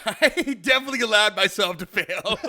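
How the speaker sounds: chuckling while admitting failure; laughing. Real, not acted. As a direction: laugh through the confession. Laughing about own failure.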